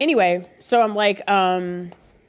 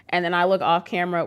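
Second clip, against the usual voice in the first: normal talking voice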